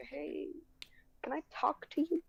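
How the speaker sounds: high whispery re-enacted request; hushed approach. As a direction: act out a timid whispered ask. Acts out approaching the teacher.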